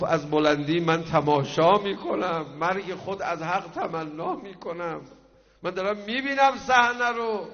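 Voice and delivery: loud, high, grieving cry; Zeinab's lament. Acted. Zeinab watching her brother besieged, loud and high. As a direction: wail the verse from the hilltop. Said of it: "گفت".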